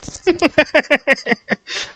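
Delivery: high wheezing laugh; breathless giggle. Real, not acted. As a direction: crack up at your own joke. Laughing at own joke.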